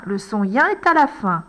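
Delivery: normal talking voice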